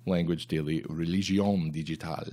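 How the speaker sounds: lightly put-on French accent on "Religion Digital"; a touch of French accent, half-hearted; mock French accent. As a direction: say the name with a hint of French accent. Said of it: "that's like a French accent".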